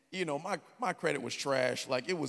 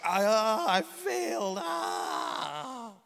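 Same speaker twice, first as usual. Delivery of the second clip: higher, cracking mock sob; retold crying; 5 semitones higher, 5 dB louder, much slower than usual. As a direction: play back his own breakdown. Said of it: "I'm, like, crying".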